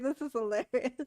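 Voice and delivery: normal talking voice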